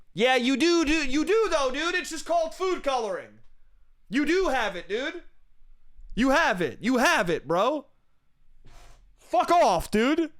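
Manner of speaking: high-pitched, incredulous anger; exasperated arguing. Acted. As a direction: protest in a worked-up, high voice. Acted furious argument with the clerk.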